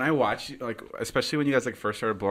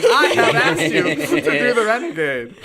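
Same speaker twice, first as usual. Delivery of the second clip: laughing; 13 semitones higher, 10 dB louder than usual. Real, not acted. Teasing host laughs at herself.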